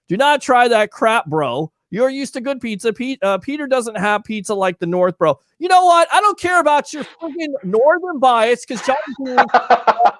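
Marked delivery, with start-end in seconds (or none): 5.6-10.1 fired-up rant. Real, not acted. Fired up about Northern bias.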